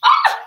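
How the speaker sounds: very high sudden yelp; scared shriek. Real, not acted. Scared of the hot oil, high sudden yelp. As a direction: jump and shriek.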